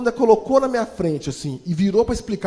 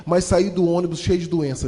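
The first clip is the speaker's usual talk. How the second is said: ordinary talk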